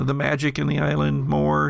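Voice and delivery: ordinary talk, nothing marked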